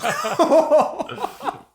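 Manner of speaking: loud shrieking laugh; high burst of laughter. Real, not acted. Laughs at dad joke.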